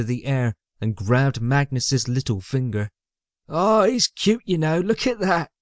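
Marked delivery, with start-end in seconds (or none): 3.5-5.5 high-pitched cooing henchman; high, delighted underling; charmed sidekick. A narrator's reading. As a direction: coo over a baby in a high voice. Magnus softens over the baby.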